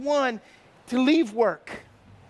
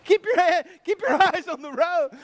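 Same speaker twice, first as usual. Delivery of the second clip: imitated scolding wife; 5 semitones higher, 4 dB louder than usual. Retold wife scolding the driver.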